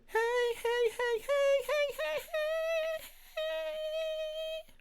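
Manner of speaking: pure falsetto calls, way up high; falsetto hey. Shown as a demonstration. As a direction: float the heys in falsetto. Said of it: "That's falsetto all the way over there".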